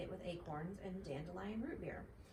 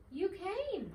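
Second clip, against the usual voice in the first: high, eager and a bit louder; excited cry; 11 semitones higher, 5 dB louder than usual. A narrator's reading. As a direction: greet him with delight. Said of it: "cried Dinky".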